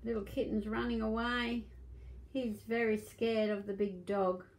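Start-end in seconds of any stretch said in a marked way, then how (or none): none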